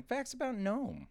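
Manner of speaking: high announcer call. Acted. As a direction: announce the segment title. Announcing segment title.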